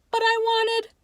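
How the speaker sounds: high, loud cut-off whine; a boy protesting, cut short; whiny kid protest. A narrator's reading. Ace protests as Nicky leaves the table, high whine.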